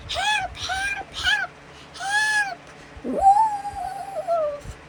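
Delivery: very high mock shrieked cries; shouting for help. A narrator's reading. Red flees the wolf calling for help, very high mock shouting. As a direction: squeal repeated cries for help, drawing out the last word.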